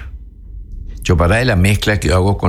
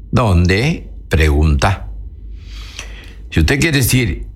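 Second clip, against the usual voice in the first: usual speaking voice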